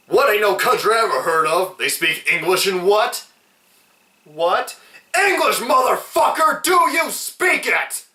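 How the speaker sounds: high, loud hammed-up gangster shout; mock Jules yell. Reviewer acting out Jules yelling at Brett, hammed-up. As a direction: bark it at a terrified man.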